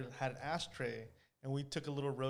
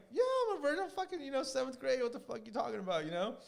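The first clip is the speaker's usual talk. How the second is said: put-on kid self; 7 semitones higher than usual. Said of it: "I'm like".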